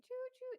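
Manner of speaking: high-pitched "choo choo"; playful high train whistle; train whistle call. Pretend. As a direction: toot it like a little train. Train whistle cheer welcoming member.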